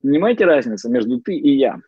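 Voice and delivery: ordinary talk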